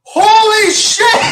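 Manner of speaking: high, loud, slow burning shout; pained shout. Real, not acted. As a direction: yell it out through the burn. Mouth burning from spice.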